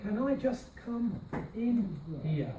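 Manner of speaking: slow, falling at the end; slow tune model. Shown as a demonstration. Teacher slowly modelling the falling tune. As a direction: drag it out, let it fall. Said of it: "going down at the end".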